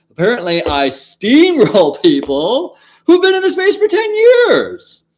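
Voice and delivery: high, loud, laughing brag; excited laughing brag. Real, not acted. Boasting to viewers about debates, in a high loud laughing brag.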